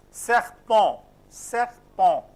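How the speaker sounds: loud model "Serpent", French nasal ending and no T; "serpent" in two separated syllables, throaty R; silent final consonant. Shown as a demonstration. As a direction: say "Serpent" as "sehr-pon", twice, deliberately. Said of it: "last consonant not to be pronounced".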